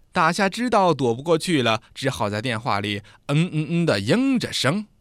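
usual speaking voice

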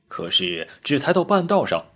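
ordinary delivery, nothing marked

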